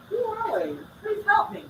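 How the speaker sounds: pleading. Acted. Role-play of fighting words.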